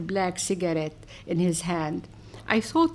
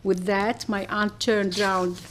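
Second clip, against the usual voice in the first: ordinary delivery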